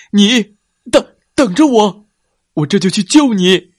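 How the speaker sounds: loud, high urgent call; urgent shout. Acted. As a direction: call out desperately. T-rex calls out to rescue.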